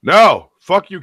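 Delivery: sudden loud no; loud burst of protest; real shout. Real, not acted. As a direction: yell it out in protest. Reacting to the story reveal.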